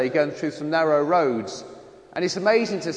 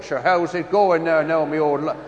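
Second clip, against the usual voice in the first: mock Irish accent from Kerry; put-on broad Kerry brogue; 3 dB louder than usual. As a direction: imitate a lad suddenly talking like a Kerryman. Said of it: "you know, from Kerry".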